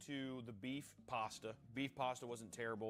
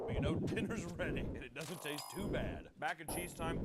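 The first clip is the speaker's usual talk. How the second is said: ordinary talk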